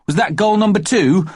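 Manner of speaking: put-on high, eager little boy; a small boy calling his mother; child's voice. A narrator's reading. Young Jeremy James calling to busy Mummy, high child voice.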